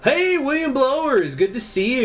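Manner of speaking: high, delighted hello; excited greeting. Real, not acted. Greeting viewer on stream.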